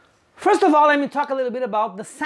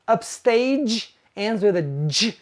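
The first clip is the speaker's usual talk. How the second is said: ordinary delivery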